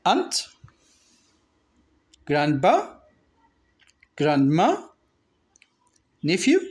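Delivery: each word spaced out and lifted for learners; repeat-after-me word drill. Shown as a demonstration. Teacher reading vocabulary for students to repeat, clear drill voice. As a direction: model each word clearly, pausing for the class. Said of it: "Repeat after me".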